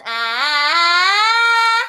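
closed, covered vowel; covered sound. Shown as a demonstration. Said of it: "I'm not opening up".

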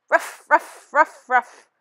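barking ruff ruff like a dog; playful dog bark roll call. Acted. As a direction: answer like a yapping dog. Canine teeth answer roll call.

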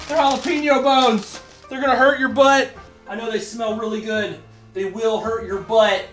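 high, playful voice for the dog; talking to dog. Real, not acted.

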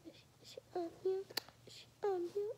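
fast whispered search. Real, not acted. Kid whispering to herself as she hunts the toy list. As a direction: whisper it to yourself while hunting.

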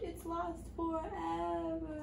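slow, drawn-out tearful sigh; weeping princess. A narrator's reading. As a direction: let it trail off in sorrow. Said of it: "she wept".

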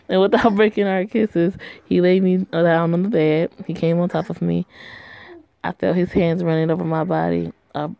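normal talking voice

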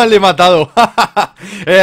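laughing while talking. Real, not acted. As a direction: burst out laughing at the kill. Kill in game, laughing.

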